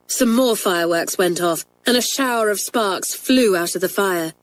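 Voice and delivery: ordinary delivery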